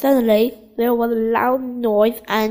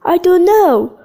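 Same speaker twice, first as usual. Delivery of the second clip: higher and louder storybook call; raised voice, read as a shouted reply; 6 semitones higher, 7 dB louder than usual. A narrator's reading. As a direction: call back over the noise. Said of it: "called Chip".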